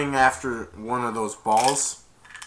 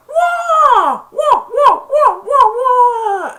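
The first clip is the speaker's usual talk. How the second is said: piercing high kung-fu yelps; one long yelp, then rapid loud ones; ninja battle cries; 13 dB louder, much slower than usual. Retold or imitated. Ninja Santa attacking, high mock martial-arts cries.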